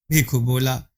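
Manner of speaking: ordinary delivery, nothing marked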